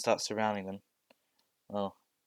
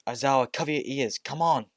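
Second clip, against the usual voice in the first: loud urgent call, pitched up; shout; 5 semitones higher, 7 dB louder than usual. Acted. Bakugo warning Aizawa to cover his ears, loud shout. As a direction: bark the warning at the teacher. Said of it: "As Bakugo shouts".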